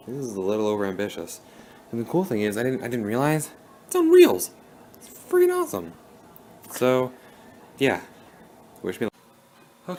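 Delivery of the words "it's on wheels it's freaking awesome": high, thrilled squeak of delight; excited squeal. Real, not acted. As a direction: squeal it out with glee. Thrilled by desk on wheels.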